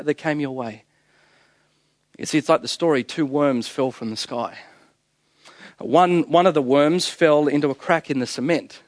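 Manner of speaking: ordinary delivery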